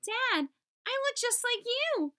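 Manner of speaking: high, joyful little voice; delighted child. Acted. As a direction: burst out with proud glee. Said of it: "he smiled".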